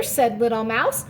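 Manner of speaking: usual speaking voice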